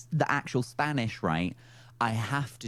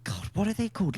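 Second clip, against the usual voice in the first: ordinary talk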